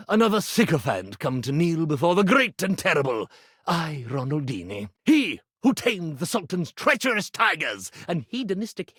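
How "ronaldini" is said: usual speaking voice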